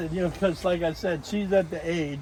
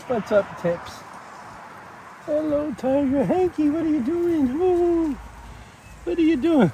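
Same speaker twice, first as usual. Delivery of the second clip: much higher sing-song voice for the birds; high pet talk; 10 semitones higher than usual. Real, not acted. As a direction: coo to them like babies.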